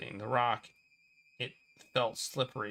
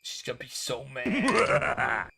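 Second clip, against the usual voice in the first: mock dread; 2 semitones higher, 5 dB louder than usual. Dread about the teacher.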